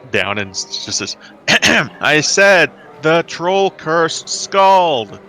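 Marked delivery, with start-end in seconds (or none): 1.2-2.0 theatrical throat clearing. Acted. Bard clears throat before announcing. 2.0-5.2 loud, high, slow repeat; exasperated announcement. Acted. Bard repeats the announcement louder.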